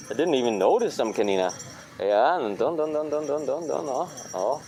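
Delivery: ordinary talk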